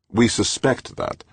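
slow, firm correction; correcting. A narrator's reading. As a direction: set her straight slowly. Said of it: "McClintock corrected".

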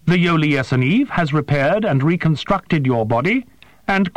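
high, precise voice of a small winged creature; formal, formal alien creature, pitched high. A narrator's reading. Creature Prath speaks.

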